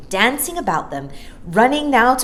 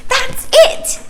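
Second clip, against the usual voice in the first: very high, loud, laughing squeal; delighted squeal; 10 dB louder than usual. Acted. Maya agreeing with Mary Poppins, high laughing squeal. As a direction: squeal with glee. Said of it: "squealed Maya delightedly".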